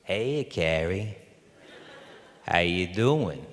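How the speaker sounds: slow, drawn-out casual greeting, lightly imitated; Rob. Director greeting his injured actor, slow lightly imitated drawl. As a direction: mimic a director's slow, feigned-casual hello. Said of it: "he's scratching his beard, and he goes".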